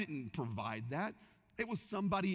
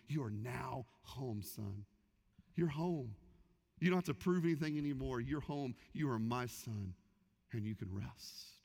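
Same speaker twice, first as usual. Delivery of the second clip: tender father; 5 semitones lower than usual. Acted. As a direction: reassure him he is finally home. Father welcoming son home.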